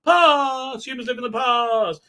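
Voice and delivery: telepathic echo. Retold or imitated. The villain speaks into Picard's mind, eerie echoing voice. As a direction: repeat past fading like a voice in his head. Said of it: "mentally tells Picard".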